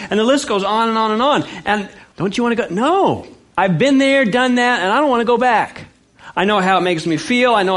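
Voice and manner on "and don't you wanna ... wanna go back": emphatic refusal. Real, not acted. Preacher voices tempted and answers.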